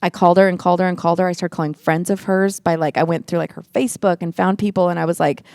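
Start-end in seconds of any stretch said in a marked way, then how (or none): none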